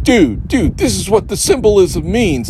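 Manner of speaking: poking fun at movie explainers; mocking the YouTube guys. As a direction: parody the smug explainer. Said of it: "these guys are like".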